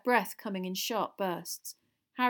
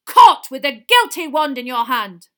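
very loud, higher roar; roared accusation; 5 semitones higher, 20 dB louder than usual. A narrator's reading. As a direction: bellow the charge at the culprit. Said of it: "Mister Diggory roared".

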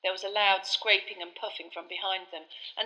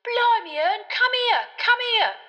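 much higher, louder shout; excited shout; 10 dB louder, slower than usual. A narrator's reading. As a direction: yell for your mate in astonishment. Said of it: "Stan shouted".

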